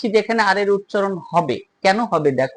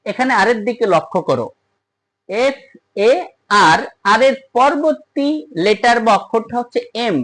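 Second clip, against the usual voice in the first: ordinary talk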